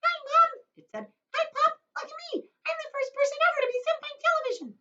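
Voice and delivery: very high mouse-like squeak; tiny squeaky voice. Acted. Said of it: "A tiny little voice... squeaking of a mouse".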